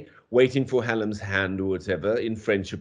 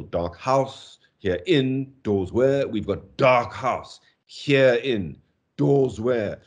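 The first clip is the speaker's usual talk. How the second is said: "dark house", "here in", "doors where" each with two heavy beats; slow double stresses; spondees; slower than usual. Shown as a demonstration. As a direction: hammer both syllables. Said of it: "These spondees".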